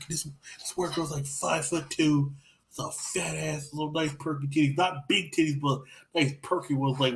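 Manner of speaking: usual speaking voice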